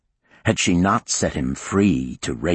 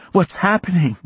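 high panicked question; horrified; 14 semitones higher, 4 dB louder than usual. Acted. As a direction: gasp it out in horror. Horrified witness.

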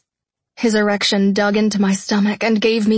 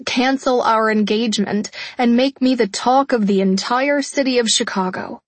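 usual speaking voice

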